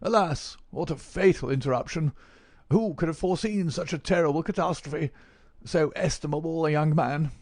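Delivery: put-on high, theatrical old professor; old man exclaiming. A narrator's reading. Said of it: "the old man exclaimed".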